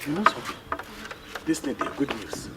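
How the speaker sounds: very low, soft voice; quiet, low-pitched confidence; hushed confiding. Acted. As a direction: share a secret under your breath. Confiding a secret errand to someone.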